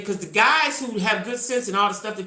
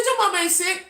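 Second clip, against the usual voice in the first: incredulous retort; 12 semitones higher than usual. Retold or imitated. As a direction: snap back in high disbelief. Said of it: "I said".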